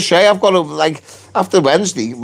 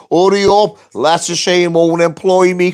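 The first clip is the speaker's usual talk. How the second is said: ordinary talk, nothing marked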